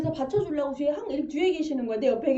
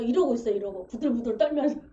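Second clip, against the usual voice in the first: ordinary talk, nothing marked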